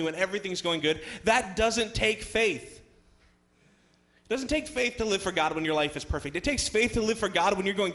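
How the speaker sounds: high, loud, hammering sermon delivery; full-force preaching. Acted. Preacher tells congregation what really takes faith, preaching forcefully. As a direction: build the list and land each point hard.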